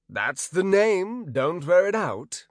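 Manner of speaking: slow, gruff lead thug; a bandit boss brushing off a joke; tough guy. Acted. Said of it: "said the lead thug".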